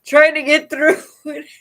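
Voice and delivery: real laughter breaking the words, high and loud; can't stop giggling while talking; words bubbling through laughter; laughing through it. Real, not acted. Laughing mid-sentence during reading.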